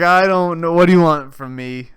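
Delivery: loud retold protest; mock exasperation. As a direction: throw it out loudly, imitating someone fed up. Imitating exasperated family reply.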